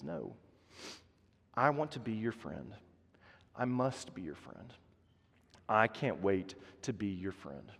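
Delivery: almost murmur the promise; Jesus gently offering friendship, hushed; soft tender promise. Acted. Jesus speaking softly.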